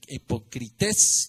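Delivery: "hipocrites" drawn out slowly with the stress on final "-TÉS"; Greek pronunciation. Shown as a demonstration. Preacher correcting himself on the Greek word, "hipocrites" stressed as "hipocri-TÉS". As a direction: repeat "hipocrites" as Greek, accent landing on the last E. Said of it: "como con tilde en la E".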